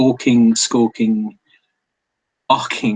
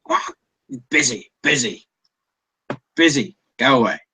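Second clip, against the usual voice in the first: lightly voiced seagull; 3 semitones higher than usual.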